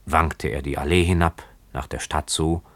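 plain reading voice

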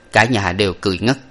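plain reading voice

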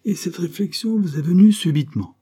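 stung, high and loud questioning; piqued sarcasm, pitched high. A narrator's reading. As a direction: throw it back, offended and sceptical. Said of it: "très piquée".

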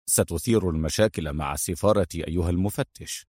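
low, soft warning; last-attempt warning. A narrator's reading. Adham making a last attempt on the inspector, low warning. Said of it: "في محاولة أخيرة".

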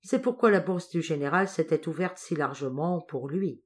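ordinary delivery, nothing marked

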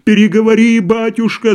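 high, loud call from a crowd; shouting crowd. A narrator's reading. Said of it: "закричали ему из толпы". (громкий выкрик из толпы)

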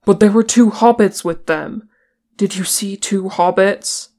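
loud, urgent outburst; bursting out. A narrator's reading. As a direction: blurt it out, unable to hold back. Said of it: "and burst out".

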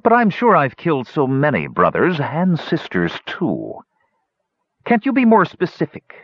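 high, taunting hunchback voice; sly, high villain mockery; mocking villain. A narrator's reading. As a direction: toy with the prisoner. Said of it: "Pitrick asked".

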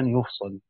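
يفصل said yufsalu, ya with damma and a light sad with fatha; reading of the others. Shown as a demonstration. Teacher shows students the remaining reading: يفصل as yufsalu. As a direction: read يفصل as yuf-sa-lu with no doubled letter. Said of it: "بضم الياء وفتح الصاد مخففا".

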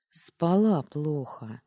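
reluctant, drawn-out grumbled excuse; mumbled grumble. A narrator's reading. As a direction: grumble it out slowly, avoiding the question. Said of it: "буркнула я".

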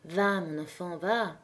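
put-on young man releasing the girl in a soft hush; low voice. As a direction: murmur it so only she hears. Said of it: "il lui dit à voix basse".

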